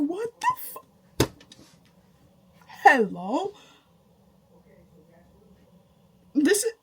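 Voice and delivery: high, loud, cut-off shock; girl answers his phone, high shocked reaction; stunned. Retold or imitated. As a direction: react like you can't believe it.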